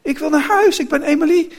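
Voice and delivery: put-on high, loud cry of a child; shouting. A narrator's reading. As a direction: shout desperately to be recognised. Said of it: "Emily schreeuwde". (hoog schreeuwend kinderstemmetje)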